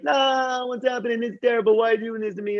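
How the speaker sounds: put-on high, pleading complaint of a wronged young man; high, hammed-up plea of innocence; whiny Joseph. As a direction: whine it up high like a hurt kid. Joseph protests being sold off.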